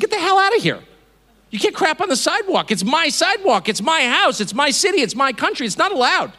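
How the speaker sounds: acted outrage in rapid, punchy bursts; indignant telling-off. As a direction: chase someone off your property, indignantly. Acts out confronting man on sidewalk.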